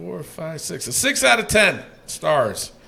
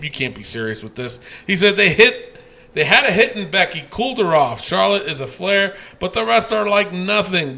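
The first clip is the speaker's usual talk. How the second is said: ordinary talk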